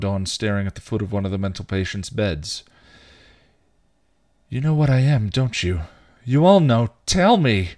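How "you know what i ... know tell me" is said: loud, high-pitched demand. A narrator's reading. Dawn questions the man in the bed, demanding. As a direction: press hard for an answer, pitch climbing. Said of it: "she was demanding".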